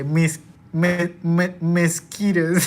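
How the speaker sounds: mosquitoes said slow as mes-kee-toes; loud mock American mosquitoes, drawn out; stammering into meskitoes; imitating American. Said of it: "hear some people say".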